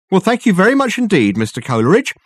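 a theatrical gentleman bowing his way out; Dirk's voice. Acted. Dirk takes his leave effusively.

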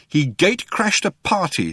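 high-pitched, understated anger; trembling with anger. A narrator's reading. Arthur tells Ford what Zaphod did, angry. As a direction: accuse him with contained anger. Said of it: "trembling with anger".

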